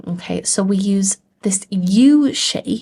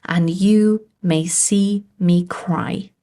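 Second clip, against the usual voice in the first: slow, measured, "you" stretched and stressed; deliberate iambic demo in three pairs; stressed and unstressed beats; slower than usual. Shown as a demonstration. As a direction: lean on "you", "see" and "cry". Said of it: "this is the same here".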